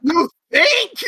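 high, loud mock sarcasm; sarcastic jab. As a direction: needle the friend with a scoff. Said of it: "You're like".

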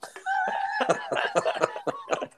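high wheezing laugh; big laugh. Real, not acted. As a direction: crack up at your own story. Cracks up at own retold story.

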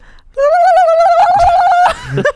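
high shrieking turkey gobble; gobbling turkey voice. Acted. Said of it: "I don't think they really sound like that".

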